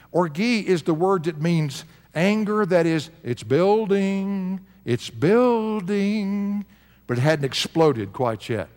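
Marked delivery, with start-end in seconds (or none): 3.2-4.6 drawn-out building. Acted. Preacher stretches the word building. 4.9-6.7 drawn-out building. Acted. Preacher stretches the word building.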